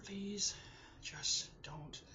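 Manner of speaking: halting words with long pauses, sliding into whisper; fighting back tears. Real, not acted. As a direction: plead with yourself not to cry, breath giving out. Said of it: "to cry".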